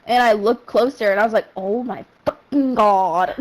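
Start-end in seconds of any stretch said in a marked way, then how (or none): 1.6-3.3 drawn out, low oh-my-God; stunned disbelief. Retold or imitated. Telling a friend what they saw up close, stunned. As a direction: let each word drag in retold horror. Retold stunned reaction to sight.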